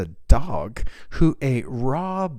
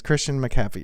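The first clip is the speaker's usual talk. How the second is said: ordinary delivery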